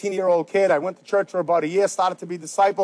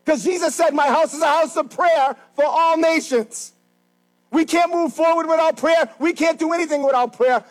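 high, loud preaching climb; rising pulpit fervour; 9 semitones higher, 3 dB louder than usual. Acted. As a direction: preach it with full force. Preaching at full force.

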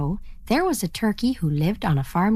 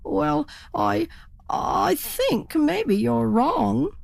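high, slow and hesitant; falter, then stretch the words out; unsure turkey; 7 semitones higher, much slower than usual. A narrator's reading. Turkey doubts the spruce hen's warning, hesitant. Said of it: "said the turkey".

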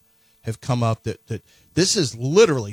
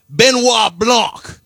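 drawn-out, higher Southern drawl; exaggerated Southern accent; 9 semitones higher, 7 dB louder, much slower than usual. Host mimicking Daniel Craig's character's name, Southern accent. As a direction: ham up the name in a slow Louisiana drawl. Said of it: "Daniel Craig doing the Louisiana accent".

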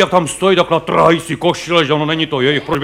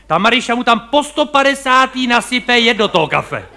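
ordinary talk

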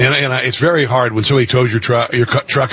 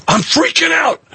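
much higher panicky voice; freaking out. Real, not acted. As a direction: let the panic spike up. Panic over stolen truck.

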